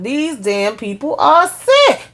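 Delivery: "These damn people are sick" slow and high, every word weighed; appalled emphasis. Real, not acted. Teller appalled by the characters.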